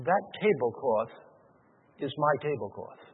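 low, soft and understated grief; a touch of sadness, spoken low and softly; tearful retelling. Retold or imitated. Old woman recognizing her tablecloth in church, quietly tearful. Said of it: "began to cry".

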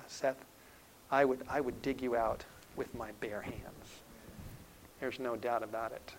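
soft, hushed fatherly vow; retold reassurance, well below full voice; solemn promise. Father promises son his bare hands, softly solemn.

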